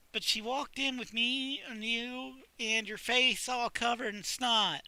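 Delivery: embarrassed librarian. Acted. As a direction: spill the awkward detail.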